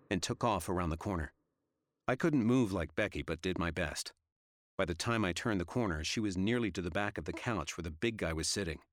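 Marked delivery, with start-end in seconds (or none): none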